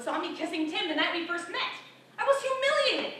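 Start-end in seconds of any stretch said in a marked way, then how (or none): 2.2-3.1 high, loud anguished cry; mortified outburst; humiliated. Acted. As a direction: wail it in mortified dismay. Recalls humiliation before neighbours.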